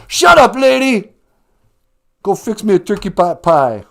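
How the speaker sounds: very loud, high mock shout; father's shout. Bender's father yelling at the mother, hammed-up shout. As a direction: bark orders at the wife.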